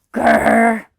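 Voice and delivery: short, loud growl in a gruff voice; bear growl. Acted. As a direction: grumble like a cross bear. Bear growls at being told no.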